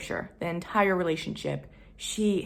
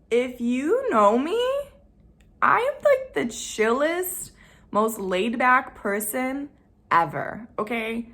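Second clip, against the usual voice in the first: high, slow, loud insisting; emphatic insistence; 6 semitones higher, 6 dB louder, slower than usual. Real, not acted. Telling viewers who know her how laid back she really is, emphatic and high. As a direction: drive home how easygoing she is, pitch way up.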